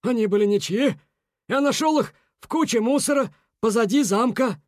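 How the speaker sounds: defensive beaver. A narrator's reading.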